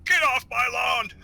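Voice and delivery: put-on high-pitched old crank voice; grumpy old-timer. Retiree griping about kids on the lawn, high cranky old-timer voice.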